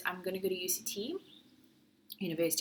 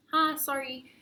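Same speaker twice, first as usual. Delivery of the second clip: mock salesperson greeting; 7 semitones higher, 3 dB louder than usual. Mimicking the salespeople running around.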